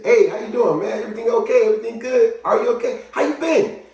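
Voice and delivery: high, lively check-in; upbeat hail, pitched well up; caring greeting. Shown as a demonstration. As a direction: greet someone to acknowledge them. Demonstrating acknowledging a person.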